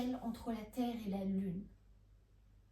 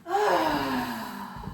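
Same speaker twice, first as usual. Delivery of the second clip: loud, falling "Ah" of satisfaction; long contented sigh; 5 dB louder than usual. Acted. Woman sighs contentedly on beach. (long soupir de contentement)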